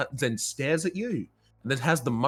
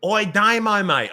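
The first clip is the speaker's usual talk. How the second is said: Aussie meme quote; 8 semitones higher, 6 dB louder than usual. Retold or imitated. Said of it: "it's the Australian meme".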